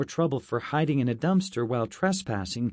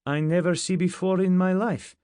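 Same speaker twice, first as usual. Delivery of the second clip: put-on high, loud protesting Russian accent; 7 semitones higher, 4 dB louder than usual. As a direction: insist on innocence in broken English. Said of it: "said Levka".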